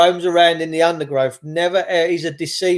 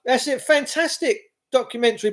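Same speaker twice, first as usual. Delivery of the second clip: ordinary talk, nothing marked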